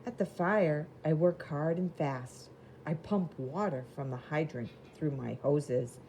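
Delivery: lively picture-book reading voice; reading to children. Acted. Teacher reading a storybook to the class, lively. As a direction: read it bright and clear for little kids.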